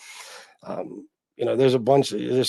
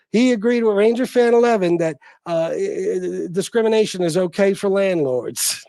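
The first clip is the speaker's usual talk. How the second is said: higher-pitched mimicking voice; Angel; 6 semitones higher, 4 dB louder than usual. Mimicking viewers reporting his stance on landlords, in a high put-on voice. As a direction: imitate a tattling critic, pitched up. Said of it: "I'm doing Angel's voice".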